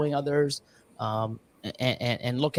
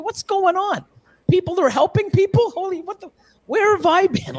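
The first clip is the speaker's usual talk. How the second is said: high, much higher astonished voice; mock amazement; 12 semitones higher than usual. Returning parent stunned by the new snake community, high and amazed.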